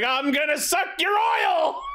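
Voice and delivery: high, shouted monster threat; mock yell of a silly vampire monster; hammy vampire robot. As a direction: ham it up like a cartoon villain. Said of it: "there's that one guy who was like".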